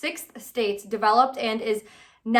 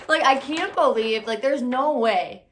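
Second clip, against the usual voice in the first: usual speaking voice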